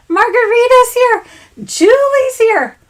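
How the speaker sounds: high, loud happy call; excited. Real, not acted. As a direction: call out each name gladly. Calling out viewer names excitedly.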